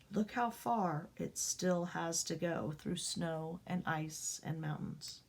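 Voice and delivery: usual speaking voice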